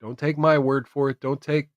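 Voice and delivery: normal talking voice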